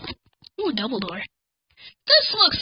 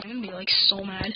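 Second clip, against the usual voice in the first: ordinary talk, nothing marked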